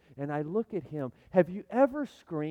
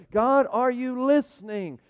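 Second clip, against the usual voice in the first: raised, drawn-out plea to God; crying out to God; 7 semitones higher, 7 dB louder, slower than usual. Acted. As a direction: call up loudly and slowly, demanding an answer. Prayer of doubt cried aloud.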